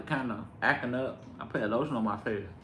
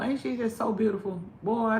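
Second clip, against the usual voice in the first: gushing; 9 semitones higher than usual. Real, not acted. Gushing over baby in high voice.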